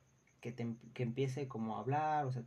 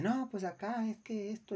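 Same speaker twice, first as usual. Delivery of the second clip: angry customer; 9 semitones higher than usual. Retold or imitated. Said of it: "Y le dice".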